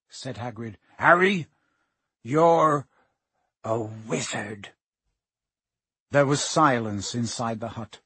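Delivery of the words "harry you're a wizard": halting, with big pauses, dropping at the end; momentous reveal. A narrator's reading. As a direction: open loudly, then sink to a slow hush. Hagrid makes a momentous reveal.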